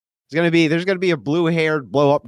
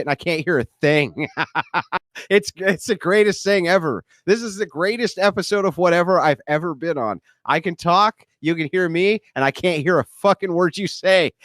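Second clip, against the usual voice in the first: temporarily deafened; 6 semitones higher than usual. Real, not acted. Can't hear the audience.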